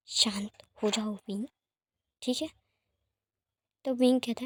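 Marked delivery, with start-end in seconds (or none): 0.1-2.5 slow and gentle reassurance; soothing. Acted. Lanjun gently soothes panicking Wing, reassuring.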